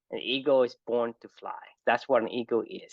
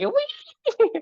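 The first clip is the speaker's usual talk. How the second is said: very high, louder squeaky whee; mouse squeal; 6 dB louder than usual. Pretend. Mouse thrown into the air.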